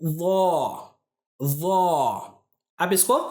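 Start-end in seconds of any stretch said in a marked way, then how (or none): 1.4-2.1 वो sounded heavy from the throat; letter demo. Shown as a demonstration. Teacher introduces the next letter, careful letter demo. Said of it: "अगला वाला जो हर्फ है वो है".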